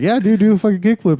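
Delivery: pushing a friend to do it; hyped urging. Retold or imitated. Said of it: "I was like".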